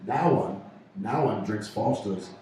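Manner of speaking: slow, low Aussie "Now on"; Australian accent. Shown as a demonstration. Host showing how Australians say it, mock-Australian. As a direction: show off a drawn-out Australian pronunciation. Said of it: "that's how you say it in Australia".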